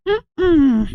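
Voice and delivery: high, kazooie hummed noise. Real, not acted. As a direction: hum it like a kazoo. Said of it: "That noise you made was very kazooie".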